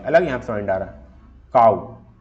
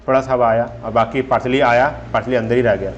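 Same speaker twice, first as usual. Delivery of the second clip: usual speaking voice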